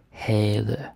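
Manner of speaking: "Havde" drawn out with no v sound; silent V. Shown as a demonstration. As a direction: say "Havde" slowly, leaving the V silent. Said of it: "you don't say the V".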